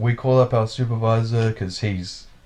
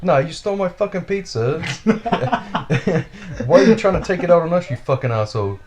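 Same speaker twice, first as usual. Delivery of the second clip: high, louder furious mock yell, speeding up; angry yelling; 8 semitones higher, 4 dB louder than usual. Pizza-theft victim shouting at the driver, heated yell. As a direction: yell it back at him, fast and heated. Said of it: "He's like".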